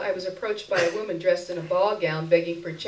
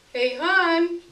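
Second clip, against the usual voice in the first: higher, louder call through the door; Jerry calling out; 11 semitones higher, 6 dB louder than usual. A narrator's reading. Jerry calling through the door, cheerful higher call. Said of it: "Jerry did his shave and haircut knock".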